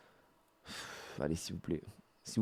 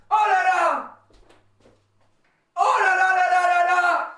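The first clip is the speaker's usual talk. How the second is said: ecstatic shouting; 13 semitones higher, much slower than usual. Real, not acted. Overjoyed after rare pull.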